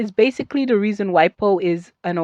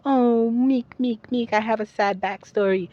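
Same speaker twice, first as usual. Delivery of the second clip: mock a meek, self-pitying villain; put-on pitiful little voice; mock sob-story villain; 4 semitones higher than usual. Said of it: "villains who are like".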